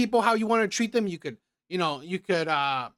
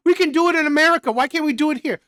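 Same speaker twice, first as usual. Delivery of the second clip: higher, louder and fast, a whiny spoiled brat; mocking entitled tourist; 5 semitones higher, 5 dB louder, faster than usual. Commentator mocks the American tourists, high whiny mimicry. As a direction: parrot them back as if they are ridiculous. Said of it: "the entitlement".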